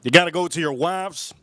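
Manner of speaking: ordinary talk, nothing marked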